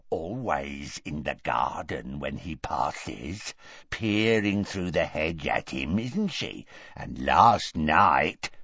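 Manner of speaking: low, soft, slow serpent voice; ruthless taunting. A narrator's reading. Morfin informs on his sister, ruthless. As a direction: tell on her in a low, sly hiss. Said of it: "but Morfin went on ruthlessly".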